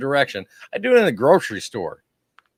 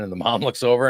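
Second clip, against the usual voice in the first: normal talking voice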